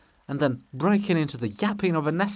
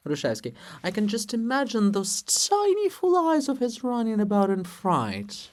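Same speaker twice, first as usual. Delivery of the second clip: ordinary talk, nothing marked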